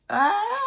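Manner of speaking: high, loud "ah" of feeling; tearful wail. Real, not acted. Reader moved by her own message, high wail. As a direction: let out a high cry, on the verge of tears. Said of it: "I even wanna cry".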